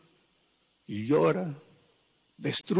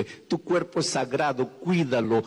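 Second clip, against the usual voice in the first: ordinary delivery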